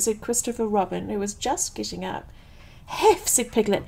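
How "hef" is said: high-pitched puffed gasp of a word; out of breath. A narrator's reading. Piglet reaching Christopher Robin's house, out of breath. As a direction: act too winded to finish the word. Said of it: "breathing so hard that he could hardly speak".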